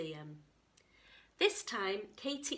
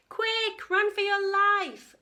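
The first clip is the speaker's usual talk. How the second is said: high, loud panicked cry; urgent warning; 12 semitones higher, 12 dB louder, slower than usual. A narrator's reading. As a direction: yell at them to flee. Hadrosaurs warn of T. rex.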